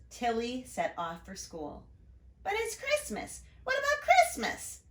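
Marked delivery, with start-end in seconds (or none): 2.4-4.8 put-on much higher, whiny little boy; little brother calling. Little brother protesting as she leaves, much higher. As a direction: protest like a small kid. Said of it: "her brother called".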